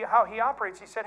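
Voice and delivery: normal talking voice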